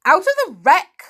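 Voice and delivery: loud, high incredulous question; surprised. A narrator's reading. Uncle echoing the little girl's answer, surprised. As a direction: echo it in astonishment, voice leaping up. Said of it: "said her uncle in surprise".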